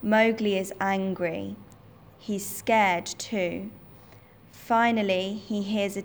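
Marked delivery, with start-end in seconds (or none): none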